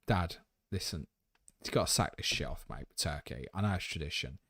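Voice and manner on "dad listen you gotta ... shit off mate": ordinary talk, nothing marked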